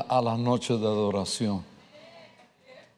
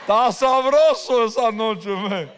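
laughing; 8 semitones higher, 3 dB louder than usual. Real, not acted. Laughing while recalling the night.

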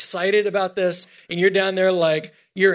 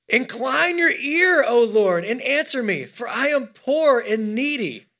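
high, drawn-out call to the congregation; psalm reading; 4 semitones higher than usual. Acted. Preacher leading the church in a psalm, declaimed slowly and high. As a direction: declaim the verse with long, raised stresses.

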